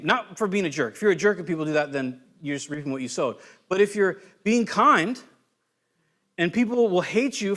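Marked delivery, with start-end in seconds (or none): none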